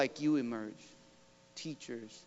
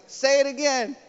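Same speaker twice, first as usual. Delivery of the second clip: eager request; 9 semitones higher, 11 dB louder than usual. Retold or imitated. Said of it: "I was like".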